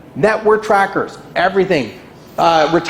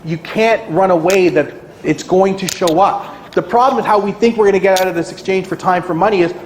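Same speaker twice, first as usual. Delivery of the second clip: ordinary delivery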